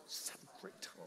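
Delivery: whispered, sugary send-off; hushed parody of church niceness; mock wimpy niceness. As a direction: whisper it like an over-gentle greeter. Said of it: "don't talk like that".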